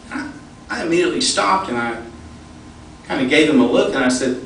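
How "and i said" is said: ordinary talk, nothing marked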